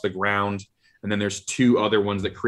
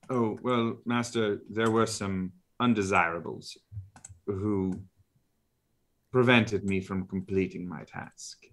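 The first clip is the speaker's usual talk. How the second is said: softer, deferential butler voice; meek drow servant; 6 dB quieter than usual. Acted. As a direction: report humbly to the master. GM voices Tootsie.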